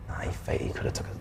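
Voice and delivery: soft unvoiced whisper. Real, not acted. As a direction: breathe it out, barely voiced. Hushed comment during play.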